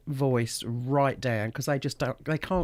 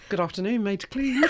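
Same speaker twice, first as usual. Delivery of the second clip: phone voice; 5 semitones higher than usual. Shown as a demonstration. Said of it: "Do your phone voice".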